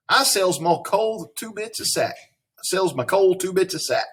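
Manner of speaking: lightly sung-out vendor's cry; coal man crying out. A narrator's reading. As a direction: call out your wares like a Southern coal man. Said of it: "the coal man cried out".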